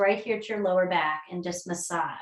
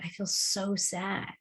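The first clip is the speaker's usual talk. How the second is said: soft slow dejected voice; quiet sad; 7 dB quieter, slower than usual. Retold or imitated. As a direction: drag it out heavily. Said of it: "He's like".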